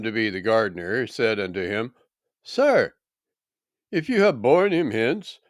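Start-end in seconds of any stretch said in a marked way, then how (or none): 2.4-5.4 high-pitched plea; anxious entreaty. Acted. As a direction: lift the voice high to entreat a stranger. Weeping woman entreats supposed gardener.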